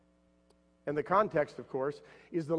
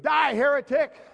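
much higher, louder hammed-up yell; mock scream; 11 semitones higher, 10 dB louder than usual. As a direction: scream it as you shove him. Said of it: "pushed him over and screamed".

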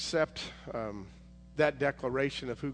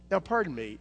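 ordinary delivery

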